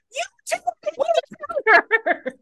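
mocking the in-laws in a squeaky falsetto; mocking squeak. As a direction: squeak it out to mock them. Mocking the couple's demand.

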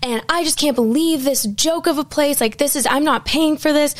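high-pitched mock rant of an irate guest; retold angry yelling. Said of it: "literally yelling".

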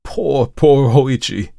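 slow, high, loud lament; anguished priest's exclamation; pitying exclamation. A narrator's reading. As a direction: cry out in pity, drawing the words out. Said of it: "the priest exclaimed".

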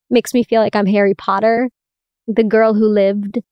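ordinary delivery

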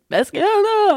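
high, loud panicked cry; frightened scream. Retold or imitated. Girls hearing knocking in the dark, high frightened shout. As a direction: shriek the question in terror. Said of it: "vi råber, og vi skriger".

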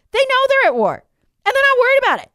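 mocking high voice. Real, not acted. Host mocks the liberal minister in a high, mocking voice. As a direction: jeer at them in a high squeal.

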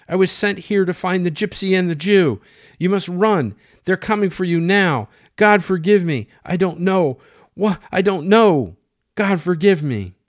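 frantic high shouted warning; yelling. Acted. Juan confessing and warning the family, yelling desperately. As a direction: yell it out in desperate panic. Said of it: "He yelled".

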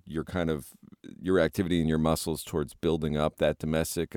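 ordinary delivery

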